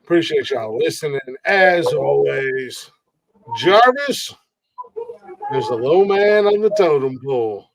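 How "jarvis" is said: higher, louder call of the name; an announcer's callout. Real, not acted. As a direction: call the guest to the mic. Host calls on guest.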